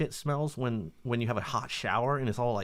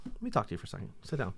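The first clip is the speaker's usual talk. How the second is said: lightly acted serious confronter, soft; quietly sit them down for a talk; Chris Hansen; 2 semitones lower, 8 dB quieter, much faster than usual. Sitting her down about the bathroom, softly stern. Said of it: "Like Chris Hansen".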